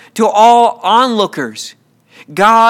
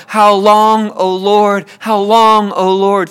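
ordinary delivery

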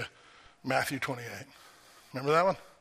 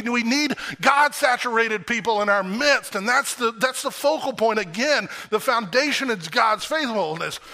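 higher, louder, building preaching; fervent preaching; 8 semitones higher, 6 dB louder than usual. Acted. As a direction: drive the promise home to the church. Preaching at full force.

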